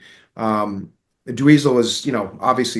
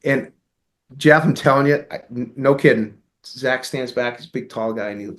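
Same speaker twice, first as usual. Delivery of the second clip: usual speaking voice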